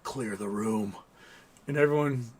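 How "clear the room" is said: low, soft command; quiet order, pitched down; calm, low-voiced order from a nurse; commanding mom. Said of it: "she tells everybody".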